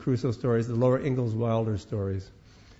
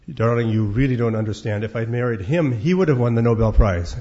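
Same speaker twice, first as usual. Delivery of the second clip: louder, pointed comeback from the wife; glaring retort; 8 dB louder than usual. Acted. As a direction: hit back at him, louder on every word. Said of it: "she glares at him and says".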